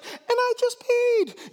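very high, drawn-out comic squeal; sheepish scared disciple. Retold or imitated. Someone in the back of the boat confessing, high comic whine.

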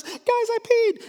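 high, drawn-out comic confession; frightened disciple. Retold or imitated. A disciple confessing to the boat in panic, comic high whine. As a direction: squeak it out like a scared man, slowly.

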